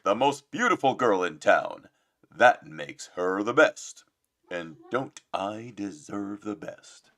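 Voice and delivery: loud, slow, swaggering Gaston; big boastful Gaston voice; Gaston impression. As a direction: boom it out like a vain hunk. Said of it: "he said it like this".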